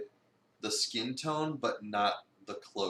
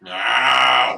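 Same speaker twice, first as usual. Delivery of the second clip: full-force mock protest shout; 16 dB louder than usual. Co-host protesting for the cat, loud shout. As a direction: yell it as the offended cat.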